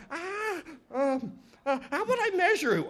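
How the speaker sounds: high, hesitant little voice; sly Anansi. Acted. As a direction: feign thinking it up, softly and high. Anansi tricks the python.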